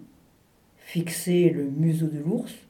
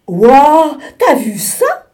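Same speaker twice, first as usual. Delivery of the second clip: much louder, higher amazed shout; excited cry; 8 semitones higher, 13 dB louder, slower than usual. Acted. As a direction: call out at the sight of the walrus. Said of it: "s'écria l'ours".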